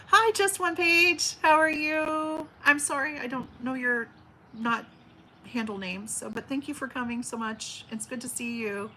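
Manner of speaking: ordinary talk, nothing marked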